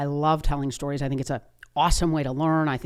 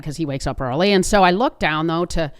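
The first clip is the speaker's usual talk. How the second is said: ordinary delivery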